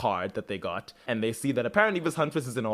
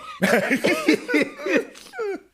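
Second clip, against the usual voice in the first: long squealing laugh; a giddy high cackle; 3 dB louder than usual. Real, not acted. Laughing at her own joke.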